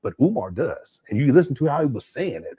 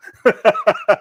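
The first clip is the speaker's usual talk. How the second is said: loud high laugh; 9 semitones higher, 7 dB louder than usual. Real, not acted. Host laughing at his own story, loud and high. Laughs after retold line.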